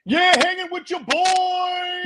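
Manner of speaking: high, drawn-out fake DJ hype; mock radio DJ. Mocking fake voices heard in radio, high hyped DJ voice. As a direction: stretch it out like a phony jock. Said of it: "you hear it all the time in radio where it's like".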